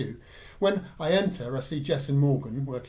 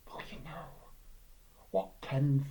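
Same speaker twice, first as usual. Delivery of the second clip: whispering; 5 dB quieter than usual. Retold or imitated. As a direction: whisper it like a naughty secret. Mother hinting at sex.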